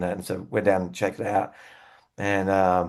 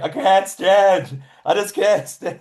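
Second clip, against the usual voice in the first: put-on slow, higher and louder, hamming up a drunk who can't get up; mock drunk bandmate; 8 semitones higher, 6 dB louder, slower than usual. Bandmate sprawled on the pub floor, mock drunk and slow. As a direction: play the guitarist too drunk to stand, slowly. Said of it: "He's just like".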